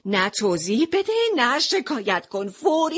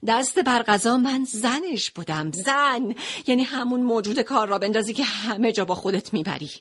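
ordinary delivery

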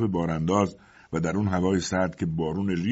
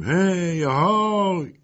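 high, drawn-out calling shout; shouted hail; 12 semitones higher, 5 dB louder, much slower than usual. A narrator's reading. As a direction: holler to someone far off. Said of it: "ما فریاد زدیم". (با فریاد صدا زدن)

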